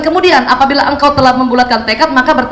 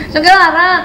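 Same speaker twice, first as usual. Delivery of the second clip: put-on whining child; 3 semitones higher, slower than usual. Shown as a demonstration. Child insisting.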